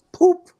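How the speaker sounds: high, loud "poop" pop; imitated popping sound. Man telling how energy left his head, imitated pop. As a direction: make a quick high pop noise. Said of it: "it actually went".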